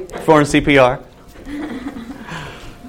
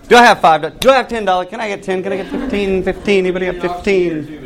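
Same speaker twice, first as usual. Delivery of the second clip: playful, higher-pitched auctioneer chant; auctioneer patter; 5 semitones higher than usual. Pretend. Teacher jokingly selling youth tickets to the class, mock auctioneer. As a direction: rattle off bids like a mock auctioneer. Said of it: "that doesn't mean I'm an auctioneer".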